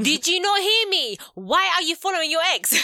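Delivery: high, loud mock confrontation. Shown as a demonstration. Co-host showing how to talk over a boyfriend, mock shouting. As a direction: raise it to a high, loud acted demand. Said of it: "my voice raised a little bit".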